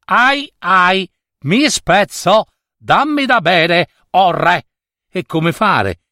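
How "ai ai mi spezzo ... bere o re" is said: high, slow pained wail of a rope; crying-out rope. A narrator's reading. Rope begs the king for a drink, crying out. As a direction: cry out high, as a rope about to snap. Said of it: "la corda cominciò a gridare".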